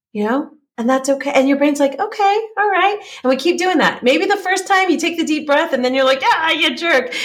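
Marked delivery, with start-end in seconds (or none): 2.0-3.0 much higher, light little acceptance; agreeable brain voice. Acted. Voicing a compliant brain. 6.2-7.1 mock road annoyance. Acting out road irritation.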